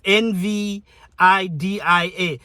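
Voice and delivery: each letter of N V I D I A said separately and slowly; spelling the brand name out for viewers; spelled out. Shown as a demonstration. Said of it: "Some people say nvidia".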